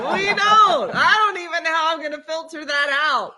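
high, loud, laughing protest; helpless laughter. Real, not acted. Host cracking up over what must be cut, loud and laughing. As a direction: wail it through real laughter. Laughing after friend's unbroadcastable remark.

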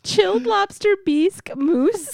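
high, "bisque" said as "beesk"; playfully wrong "beesk" for "bisque"; deliberate mispronunciation. Acted. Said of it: "I'm just gonna do it on purpose".

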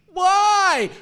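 high, loud anguished wail of why; man cry. Retold or imitated. Pastor acting out his failed repair, loud high wail. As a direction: let the why burst out like a frustrated man crying without tears. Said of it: "my man cry, which is like".

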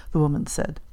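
usual speaking voice

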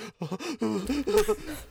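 high wordless freak-out noise; mock high-pitched panicking; imitated freak-out. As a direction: act out losing it. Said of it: "you're gonna be like".

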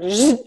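short buzzing zap noise; voiced lightning-jolt sound effect; imitated electric zap. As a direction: buzz like a sudden shock.

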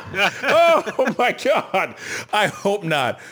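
laughing hard. Real, not acted. Bursting out laughing.

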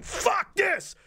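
sudden high scream. Retold or imitated. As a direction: burst out screaming, pitch flying up. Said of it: "Just screaming".

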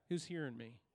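ordinary delivery

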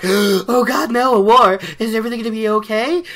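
high, loud, rushed mock panic; hammed-up terror of frightened townsfolk; panicked villagers. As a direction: gasp and then babble in fright. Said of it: "And everybody's like".

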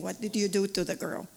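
ordinary delivery

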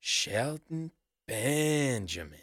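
Shelton and Benjamin drawn out, split by a pause; imitating the Usos. Showing how the Usos say Shelton Benjamin, drawn-out imitation. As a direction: stretch "Shelton", wait, then draw out "Benjamin". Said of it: "I love the way they do the".

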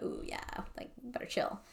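very soft near-whisper; imitated hushed caution. Host imitates neighbor hearing the cops knock, hushed. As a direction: drop to a breath, as if backing off.

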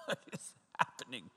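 a soft, unvoiced, puzzled whisper; whispered confused friend. Friend on the phone, baffled by the yelling, whispered. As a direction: breathe it out, baffled. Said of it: "They're like".

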